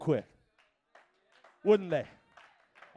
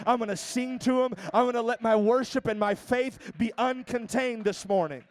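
raised, driving preacher's delivery; full-force preaching; 4 semitones higher, 4 dB louder than usual. Real, not acted. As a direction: preach it out with conviction.

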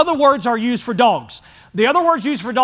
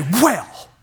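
offended huff. Retold or imitated. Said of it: "She didn't say".

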